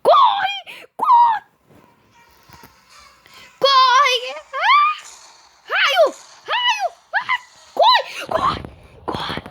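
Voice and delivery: high, loud frantic shouts of run; panicked screaming. Real, not acted. Player fleeing lightning in game, screaming in panic. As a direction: scream at everyone to run as the danger hits.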